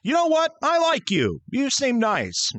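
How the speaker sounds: high-pitched, friendly burst; sudden liking. Acted. Station worker warming to eager elf, high and friendly. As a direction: pitch it high, delighted with the applicant. Interviewer takes to Herbie.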